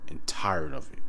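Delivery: low, hushed, whispered exasperation; fed-up whisper. Real, not acted. Speaker sick and tired of the debate, fed-up whisper. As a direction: let it sink into a weary whisper.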